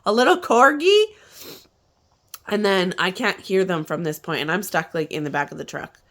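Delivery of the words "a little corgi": high, loud eager question; bursting out with hope; excited reply. Retold or imitated. Husband answers host about lost corgi, eager and loud. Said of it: "He's like".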